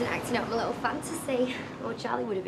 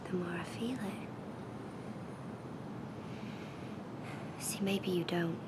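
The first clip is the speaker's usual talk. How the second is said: soft, low, breath-led confession; intimate seductive whisper; 4 semitones lower, 9 dB quieter than usual. Acted. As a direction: whisper it close, with long pauses. Woman seduces reluctant man, close up.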